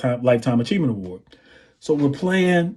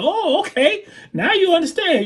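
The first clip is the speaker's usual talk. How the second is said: [name]. Retold or imitated. Singer approving the drummer's groove, imitated high voice. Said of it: "And he's like".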